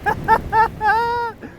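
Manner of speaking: very high giggle; high delighted laugh. Real, not acted. Laughing at fish.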